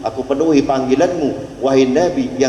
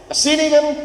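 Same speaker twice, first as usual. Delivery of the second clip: a high, raised call; 11 semitones higher than usual. Retold or imitated. The Prophet calls the lizard, raised and high. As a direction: call it over from afar.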